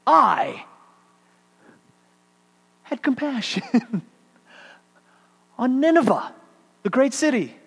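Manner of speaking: high, loud, declaimed "I"; ringing lyrical proclamation; lyrical recitation. Shown as a demonstration. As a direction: proclaim it like verse, lifted high. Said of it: "starts with the emphatic pronoun".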